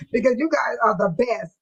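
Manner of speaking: normal talking voice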